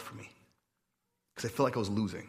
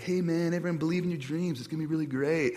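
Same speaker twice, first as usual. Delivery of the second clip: higher-pitched, breezy motivational-speaker voice; upbeat spiritual director; 5 semitones higher, faster than usual. Retold or imitated. Pastor mimicking the director's pep talk, upbeat higher-pitched impression. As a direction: mimic a relentlessly encouraging retreat leader. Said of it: "he got up and he's like".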